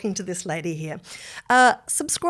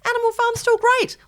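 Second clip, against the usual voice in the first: high, sing-song mock reassurance, louder; mock soothing; 7 dB louder than usual. To crying Orwell fans, a high patronising mock comfort. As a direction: pat them on the head with your voice. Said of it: "I'm like".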